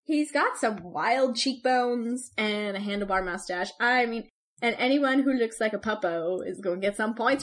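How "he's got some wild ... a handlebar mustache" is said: ordinary delivery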